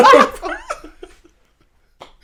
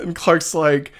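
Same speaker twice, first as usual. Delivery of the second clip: normal talking voice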